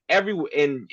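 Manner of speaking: ordinary delivery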